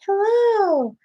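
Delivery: bright teacher hello. Retold or imitated. Cheerful greeting, next class.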